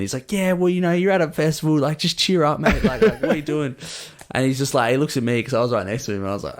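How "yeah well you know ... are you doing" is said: high, insistent matey urging; cheery festival stranger. Retold or imitated. Stranger insisting the tired guy cheer up, high chummy pep talk. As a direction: mimic a bloke who won't let you mope.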